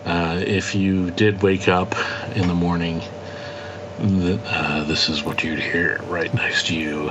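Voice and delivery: low, soft seductive bedroom voice; sexy morning voice. Pretend. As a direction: croon it low to the ladies. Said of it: "It's a sexy voice".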